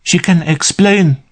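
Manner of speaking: high, urgent blurt. A narrator's reading. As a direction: burst out with it. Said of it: "Carla Loveton blurted".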